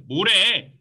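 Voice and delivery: loud, high two-syllable comeback; acted brush-off; dismissive retort. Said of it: "딱 두 글자로 대답한 겁니다".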